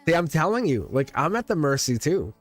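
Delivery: ordinary talk, nothing marked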